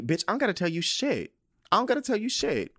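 high-pitched, acted indignant retort; defiant refusal. Acting out defiant refusal to friend.

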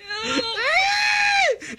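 shrill nervous laughing scream; high shrieking laugh. Real, not acted. About to eat the rotten sauce.

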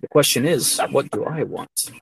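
low, softened self-questioning bedroom talk; mock seduction as a musing monologue; Jeff Goldblum. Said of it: "talks like that in bed".

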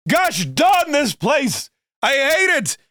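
exaggerated Bernie impression, slow and high; Bernie Sanders grumbling. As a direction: ham up a cranky rich senator's complaint. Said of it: "just goes".

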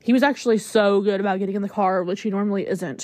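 normal talking voice

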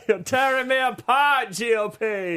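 a laughing, over-the-top parody wail; hammed-up movie outburst. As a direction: cry it out as overacted film melodrama. Parody of famous film line.

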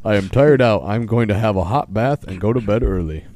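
loud, hammed-up bear voice; Polar Bear. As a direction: announce it loudly as a storybook bear. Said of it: "Do you wanna do Polar Bear's voice?".

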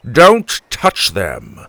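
loud, slow warning growl; angry old man's growl. A narrator's reading. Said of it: "Grandpa growled".

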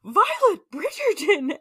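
hammed-up scandalised reprimand; scolding exclamation. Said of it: "Lady Danbury goes".

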